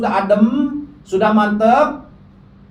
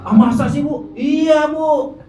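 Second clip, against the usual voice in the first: higher-pitched voices of astonished wives; mock disbelief, pitched up; acted incredulous gossip; 5 semitones higher than usual. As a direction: play gossiping women marvelling at each other.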